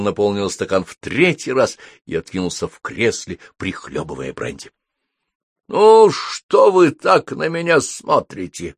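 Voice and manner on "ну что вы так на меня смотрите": high, loud, slow question; put-out query. A narrator's reading. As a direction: draw the question out, bothered by the stares. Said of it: "поинтересовался он". (громкий протяжный вопрос)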